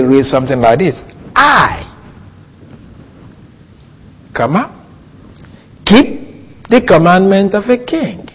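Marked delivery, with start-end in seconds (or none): 1.4-8.3 "I" set off high, long pause before "keep"; pause after I. Shown as a demonstration. Teacher reading a Hebrew line literally, pause after "I". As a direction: break sharply between "I" and "keep". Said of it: "you know why I said comma".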